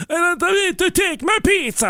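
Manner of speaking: high cartoon Italian accent; jolly italian pizza chef. Ambrogio voiced.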